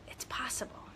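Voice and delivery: hushed near-whisper; тихий шёпот. Acted. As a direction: whisper it like a quiet answer from above. Voicing God's gentle answer.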